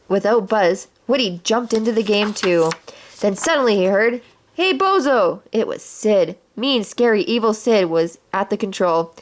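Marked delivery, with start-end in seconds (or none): 4.5-5.4 bully taunt. Acted. Sid taunts from the controls.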